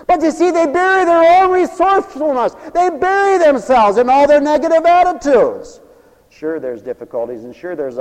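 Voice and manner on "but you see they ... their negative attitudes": loud, high, soaring preacher's cry; preaching at full force. Acted. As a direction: preach it out to the whole hall.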